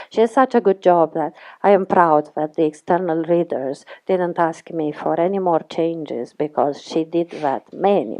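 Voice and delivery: usual speaking voice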